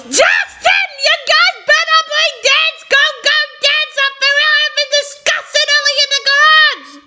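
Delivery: high-pitched yell to someone in another room. A narrator's reading. Jason yelling at Justin upstairs, yelling. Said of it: "Jason yells".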